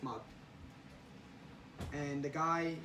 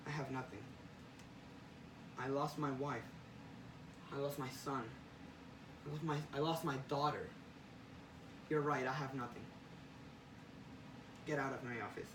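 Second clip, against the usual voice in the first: low, subdued admission of loss; defeated; 4 semitones lower, 7 dB quieter than usual. Acted. Jonah admitting to Spider-Man he lost everything, defeated. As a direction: say it quietly with long pauses, beaten.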